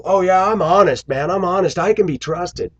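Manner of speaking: high-pitched, insistent mock pleading; exaggerated high-voiced self-defense; mock protesting. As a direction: mimic someone loudly vouching for their own honesty. Mimics people protesting their honesty.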